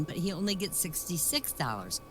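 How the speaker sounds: normal talking voice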